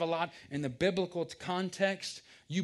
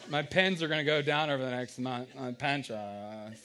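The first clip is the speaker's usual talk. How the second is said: mock worrier; 3 semitones lower, 3 dB louder than usual. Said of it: "If anybody talks like that".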